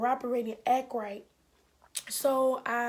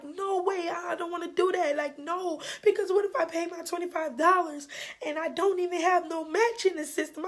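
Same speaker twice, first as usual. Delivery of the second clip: higher, louder, exasperated no way; upset refusal; 7 semitones higher, 4 dB louder than usual. Retold or imitated. Caller refusing the clerk's offer, exasperated and high-pitched. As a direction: push back hard, frustrated and pitched up.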